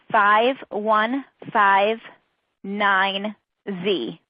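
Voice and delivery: one character at a time, with pauses; slow dictation. Real, not acted. Presenter reads codes for credit, slowly. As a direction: read out very slowly, spaced apart. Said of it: "I will read them slowly".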